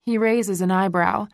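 plain reading voice

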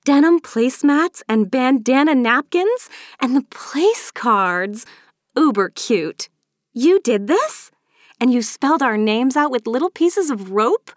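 high-pitched amazed delight; excited gushing. A narrator's reading. Maeve admires her friend's table decorations, gushing excitedly. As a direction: gush in high, wondering bursts.

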